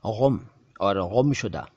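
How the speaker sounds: गुम और गुमशुदा with throaty gh; wrong nukta sound. Shown as a demonstration. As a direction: mispronounce गुम और गुमशुदा as ghum, ghumshuda. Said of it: "नुक्ता लगा देते हैं ग के नीचे".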